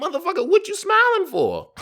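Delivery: very high, loud mock outrage; comically indignant yell; retold indignant retort. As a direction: squawk it in exasperated disbelief. Retold retort to smiling friend.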